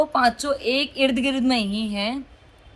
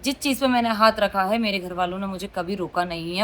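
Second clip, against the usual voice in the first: ordinary talk, nothing marked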